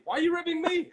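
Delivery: very high, loud mock squeal; hammed-up comic indignation; high-pitched protest. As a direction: throw it back in a squawk. Acted argument, throwing it back.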